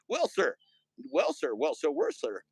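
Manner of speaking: high, folksy Well, sir; folksy old-timer. Acted. Streamer voicing a folksy old-timer, high pitched.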